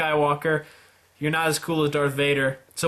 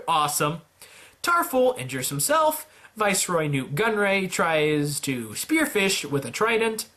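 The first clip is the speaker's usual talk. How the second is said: ordinary delivery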